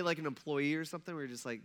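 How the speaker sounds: usual speaking voice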